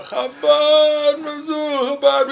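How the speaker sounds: high, slow, loud chant of "Chabad Mizzou"; mock screaming of the slogan; imitated screaming. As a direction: scream it into his ear. Visitor back yelling, imitated.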